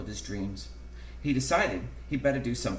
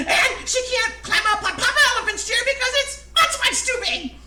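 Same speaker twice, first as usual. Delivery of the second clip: very high, loud squeal through laughter; hysterical laughter; 9 dB louder than usual. A narrator's reading. Narrator cracking up at own elephant joke, hysterical high squeals. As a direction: build from giggly to helpless shrieking. Silly tale builds to hysterics.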